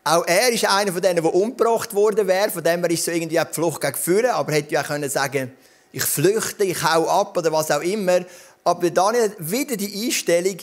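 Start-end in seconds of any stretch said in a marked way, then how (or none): none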